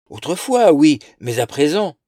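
high, loud scoffing; dismissive. A narrator's reading. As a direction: brush it off. Dismissive sister.

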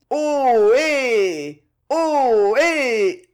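loud, high drawn-out herder's holler; two-tone mountain call. A narrator's reading. Pierre calls through cupped hands like Savoy cowherds, two-tone holler. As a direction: call across the mountains in two long notes. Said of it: "en syllabes longues sur deux tons".

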